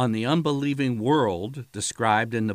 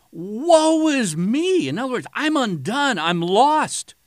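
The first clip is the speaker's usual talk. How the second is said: anguished cry; 10 semitones higher, 6 dB louder than usual. Acted. Isaiah in God's presence, crying out in anguished dread. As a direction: cry out as a man undone before God. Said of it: "he goes".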